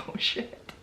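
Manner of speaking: whispered with no voice, caught out; hushed oh shit. Retold or imitated. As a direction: breathe it out as a nervous whisper. Cops fear they broke wrong door.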